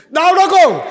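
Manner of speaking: high, very loud shout; calling out. Acted. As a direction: yell it out as an urgent demand. Calling out for the machete.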